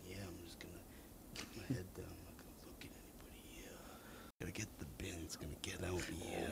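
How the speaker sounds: very soft, low whispery muttering; barely voiced, keep it to yourself; muttered self-talk. Shopper psyching himself up to grab bins, low whisper. Acting out his own muttered inner thoughts.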